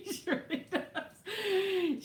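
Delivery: high bursts of real laughter through the words; laughing. Real, not acted. Owner laughing about her jumpy dog, laughing.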